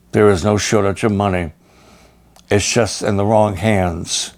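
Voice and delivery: ordinary delivery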